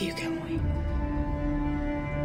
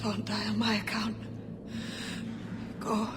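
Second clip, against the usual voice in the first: faint, breath-only voice; weak dying whisper; 5 semitones higher, 7 dB quieter than usual. Acted. Dying woman urging her friend to go, whispering weakly. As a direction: whisper it with failing strength.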